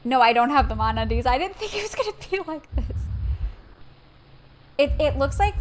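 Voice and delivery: real startled protest; loud outburst. Real, not acted. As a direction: burst out in alarm. Shocked reaction to game.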